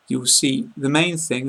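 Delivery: ordinary delivery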